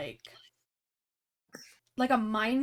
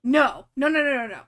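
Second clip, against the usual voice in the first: emphatic no; 2 semitones higher, 9 dB louder than usual. Real, not acted. GM firmly rejecting player's idea.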